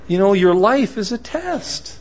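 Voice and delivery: ordinary delivery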